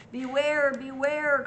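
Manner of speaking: loud high warning call; calling out beware. Acted. Bees warning the bear, loud high call.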